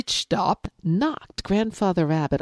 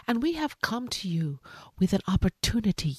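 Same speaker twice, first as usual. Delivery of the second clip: hushed, breathless pitch to the lion; whispering rabbit; 3 semitones higher, 5 dB quieter, faster than usual. Acted. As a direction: whisper it like a nervous secret.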